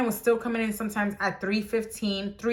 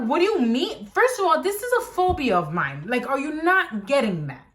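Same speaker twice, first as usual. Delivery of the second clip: indignant outburst; 6 semitones higher than usual. Retold or imitated. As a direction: push back at the boss, outraged. Arguing with boss about phobia.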